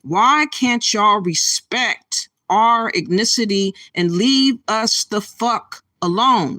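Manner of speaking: ordinary talk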